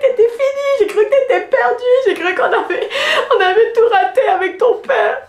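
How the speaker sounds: very high, laughing mock-tearful gushing; hammed-up proud mum to the puppy; high baby-voice mock sob; mock emotional dog talk. Gushing over dog, mock crying.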